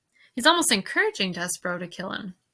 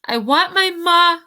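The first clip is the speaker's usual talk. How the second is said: high, loud cry for her ma; 9 semitones higher, 8 dB louder than usual. A narrator's reading. Mig cries for her ma into the silent world, crying out. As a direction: cry it out to the world, raised and pleading. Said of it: "cried Mig".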